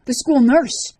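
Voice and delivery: loud, high scream of accusation. A narrator's reading. Liz to Rorik in the locker room, screaming. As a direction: scream it at him, listing the horrors. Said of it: "she screamed".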